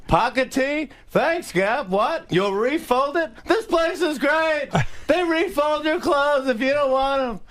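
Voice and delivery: high-pitched yelling comedian impression; Jerry Seinfeld. Rival comic answering about the Gap, high mock yelling. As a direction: holler back in a high, stretched comic whine. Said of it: "we're just yelling back and forth".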